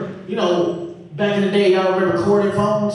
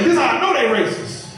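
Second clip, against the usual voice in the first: high, heated rant; ranting dad; 7 semitones higher than usual. Retold or imitated. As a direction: rant like an outraged father. Dad ranting about Disney.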